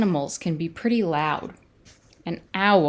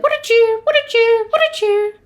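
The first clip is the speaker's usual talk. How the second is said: loud, high repeated birdsong phrase; cardinal call; 9 dB louder than usual. A narrator's reading. Cardinal keeping Owl awake, loud high bird call. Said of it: "Cardinal said".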